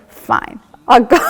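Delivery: mock-grudging, breaking into laughter; laughing surrender. Patient giving in to the hummingbird, laughing surrender. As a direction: give in, then burst out laughing.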